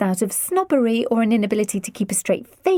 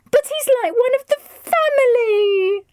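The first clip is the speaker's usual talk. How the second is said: high, loud anguished cry; 5 dB louder than usual. A narrator's reading. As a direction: cry it out in dismay. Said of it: "Alice cried".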